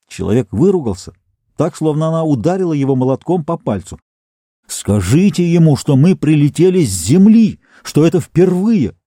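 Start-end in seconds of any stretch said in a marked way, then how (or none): 4.7-9.0 urgent plea. Acted. Captain pleads urgently.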